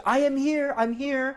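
"here" leaned on to stress the present; "I am here" said high, pressing on "here". Shown as a demonstration. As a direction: pitch "here" up to mark being here now. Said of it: "현재를 조금 더 강조하려면".

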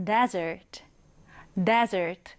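"desert" stressed hard on DE, second syllable light; stress on the front. Shown as a demonstration. Teacher models "desert" for students, stress on the front. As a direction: punch the DE of "desert". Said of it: "강세는 앞에 강하게 주면 됩니다".

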